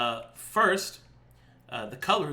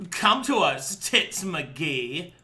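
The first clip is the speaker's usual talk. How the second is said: louder, higher start, settling on the name; odd summoning voice; 4 semitones higher, 4 dB louder, much slower than usual. Acted. Effie calls the servitor to come, odd put-on voice. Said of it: "what happened to your voice".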